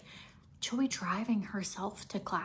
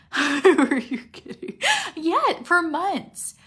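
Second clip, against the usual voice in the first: laughing loudly; 6 semitones higher, 10 dB louder, slower than usual. Real, not acted. Laughing at her own weepiness.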